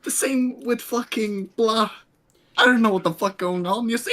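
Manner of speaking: normal talking voice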